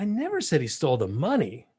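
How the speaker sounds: "money" stressed with a pitch swoop at the end. Shown as a demonstration. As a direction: save the weight for "money". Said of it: "all through the inflection of my voice".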